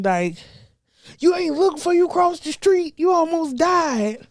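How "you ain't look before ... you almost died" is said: high, stern parent scolding; a parent scolding a kid who nearly died. Retold or imitated. Parent to the child who almost got hit, high stern scolding.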